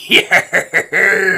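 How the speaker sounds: slurred laughing pirate groan; tipsy pirate cheer; drunk pirate. Pretend. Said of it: "You can imagine me walking around all day going".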